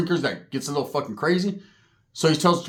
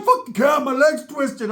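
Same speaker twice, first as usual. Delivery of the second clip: strained whine; 8 semitones higher, slower than usual. Retold or imitated. Sharky voiced, hurt on ground.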